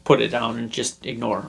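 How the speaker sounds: ordinary delivery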